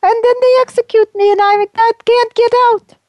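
high, far-off wailing ghost voice, forlorn; ghost of Saint Valentine. Acted. Saint Valentine's ghost answering the host, in a high wailing voice. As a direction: wail it like a lost saint calling from afar.

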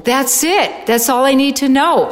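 re-enacted elated certainty; excited realisation. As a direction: exclaim it like a sudden discovery. Acting out her moment of realisation.

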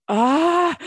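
high, loud playful scream; short high shriek of mock panic. Writer reacting as the show came out, mock scream.

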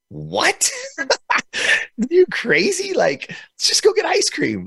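retold incredulity leaping up high; mom's disbelief. Mom answering child's store request, high mock disbelief. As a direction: imitate mom's baffled reaction, voice shooting up.

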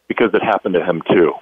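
low, softened, measured answer; voice of God. Retold or imitated. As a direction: lightly voice a calm God. God's answer in the retold argument.

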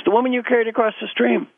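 high, exasperated accusation; lightly acted angry monk; mock angry. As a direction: insist indignantly, pitched right up. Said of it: "The angry monk says".

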